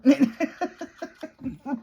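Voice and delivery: a high burst of laughter; loud laugh. Real, not acted. Finishing the story of the wrong day, laughing out loud. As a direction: laugh at how silly it was.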